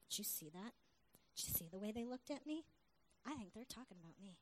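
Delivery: hushed, low paranoid aside; imitated suspicious undertone; mock paranoid. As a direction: confide it softly, as if people are watching. Acts out husband feeling watched.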